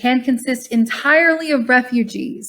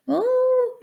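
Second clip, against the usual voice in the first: sudden high yelp of surprise; a startled oh, jumping way up in pitch; surprised oh; 4 dB quieter than usual. Real, not acted. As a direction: caught off guard by the fan stopping. Fan suddenly stops mid-reading.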